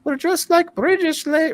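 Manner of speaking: high-pitched prim British lady voice, lifted far up; British lady impression. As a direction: put on a sweet British stewardess accent. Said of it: "the British lady says".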